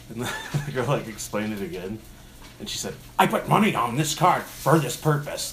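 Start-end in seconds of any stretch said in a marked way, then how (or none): 3.2-5.5 high, loud imitation of outrage; hammed-up angry complaint; customer flipping out. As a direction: protest like an indignant customer. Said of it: "like, flips out".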